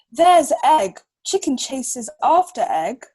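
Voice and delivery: animated picture book read-aloud; reading to a child. Acted.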